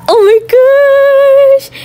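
overwhelmed with delight; thrilled squeal. Real, not acted. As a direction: gasp then a very high slow squeal. Seeing her dream pink bedroom.

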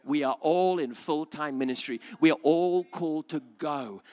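usual speaking voice

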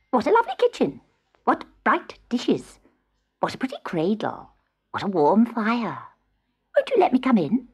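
lightly voiced hobgoblin, coaxing; wheedling hobgoblin. Hobgoblin begging the kitten to let him in, wheedling. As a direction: coax your way in with admiring exclamations.

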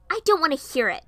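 high, forceful angry cry; angry outburst. Acted. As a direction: snap loudly, pitch shooting up. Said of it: "I turned around in anger".

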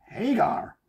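high American-style "Hagar."; mock American accent. Imagined American caller naming the strip, mock accent. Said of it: "I won't do any more of that".

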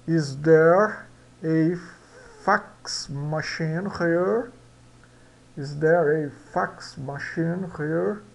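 very slow, "fax" said with a plain A; slow model sentence. Shown as a demonstration. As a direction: model the question slowly for students. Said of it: "esse A".

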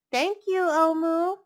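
put-on high, loud grateful child; the boy thanking Omu; little boy voice. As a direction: thank her brightly.

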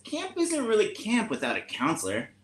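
a hint of an earnest little camper; mole camper. Mole telling the other campers, lightly put-on high voice. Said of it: "Then the mole says".

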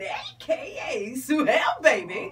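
loud, drawn-out name call; slow and loud, showing off the name; hyped stage name. Real, not acted. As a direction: announce yourself like a headliner. Announcing her stage name with flair.

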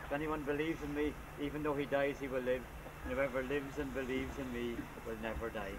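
normal talking voice

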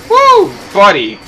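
very high, loud, drawn-out whoop; excited whoop at a play. Real, not acted. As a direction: cheer it out. Cheering in game.

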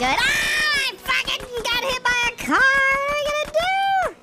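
high shocked yell; startled shout. Real, not acted. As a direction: yelp at the crash. Hit by a car in game.

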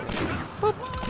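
very high, soft boop; tiny high-pitched sound effect; playful boop noise. Pretend. Player making a sound effect mid-game, playful boop.

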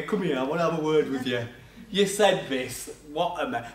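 low, stern heavenly father, a touch mock; a lightly voiced God calling you in for a chat; God having a word. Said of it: "he'll probably go".

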